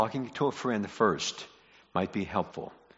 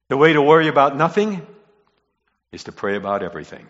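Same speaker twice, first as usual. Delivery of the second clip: brisk statement; 7 dB louder, faster than usual. Real, not acted. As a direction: state it briskly, falling away at the end. States key line, then repeats it.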